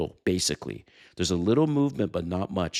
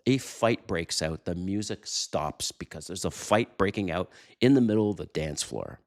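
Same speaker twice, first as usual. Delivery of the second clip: ordinary talk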